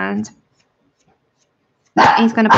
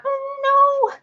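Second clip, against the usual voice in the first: very high, whiny voice for an excited dog; squeaky, hammed-up whimpering words; mock crying dog. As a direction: imitate a pet crying with excitement. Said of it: "starts all his crying like".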